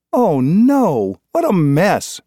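high, loud cry of dismay; alarmed mother crying out; cried out. A narrator's reading. Mother bursting in on broken dishes, high loud cry. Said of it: "she cried".

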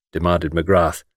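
ordinary delivery

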